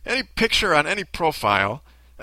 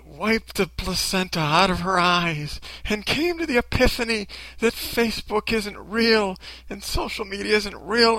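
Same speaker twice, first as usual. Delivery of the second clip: mock a starry-eyed do-gooder in a raised voice; making fun; 6 semitones higher than usual. Commentator mocking an internet model's big epiphany, gushing derisively. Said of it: "I was making fun of it".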